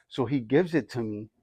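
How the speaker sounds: ordinary delivery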